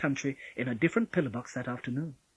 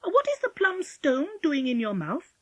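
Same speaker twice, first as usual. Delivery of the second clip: put-on high, curious child; interested; 11 semitones higher, 4 dB louder than usual. A narrator's reading. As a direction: ask with keen interest, pitched up. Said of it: "Polly asked with interest".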